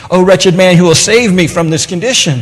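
loud, high-pitched desperate plea; preacher's impassioned lament at full voice; anguished outcry. Acted. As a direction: cry out for rescue, dramatically. Acting out Paul's anguished cry.

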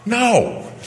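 high, exclaimed NO; forceful correction; emphatic rejection. Real, not acted. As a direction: cut off the mock idea with a high-pitched denial. Rejects the mocked idea.

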